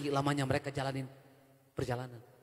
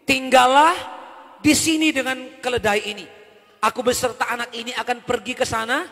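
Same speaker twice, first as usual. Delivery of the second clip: loud, higher proclaimed Bible reading; scripture read aloud; 8 semitones higher, 12 dB louder than usual. Acted. Pastor reading Genesis to the congregation, loud proclaiming. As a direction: declaim the verse to the church.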